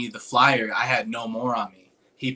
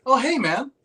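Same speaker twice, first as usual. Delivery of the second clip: high, louder hello to a supposed acquaintance; imitated delighted greeting; enthusiastic retold greeting; 12 semitones higher, 5 dB louder than usual. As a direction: greet him brightly, pitched way up. Acts out an editor's friendly greeting.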